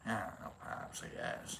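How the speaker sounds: whispered, grudging answer to Pops; barely voiced shrug of a reply; mumbled reluctant reply.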